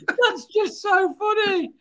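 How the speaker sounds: high, slow words through real laughter; can barely get it out for laughing; laughing helplessly. Real, not acted. Host cracking up as his internet dies mid-show, laughing.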